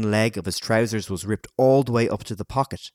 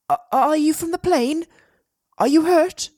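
high, loud, stammered start; anxious boy; 7 dB louder than usual. A narrator's reading. Boy asks hidden crash survivors urgent questions, high and loud. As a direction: call out urgently in a boy's voice.

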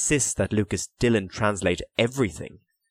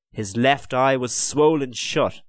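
ordinary delivery, nothing marked

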